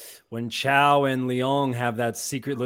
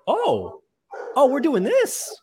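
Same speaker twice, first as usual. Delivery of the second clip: excited; 13 semitones higher, 4 dB louder than usual. Retold or imitated. Retold excited reaction to scene.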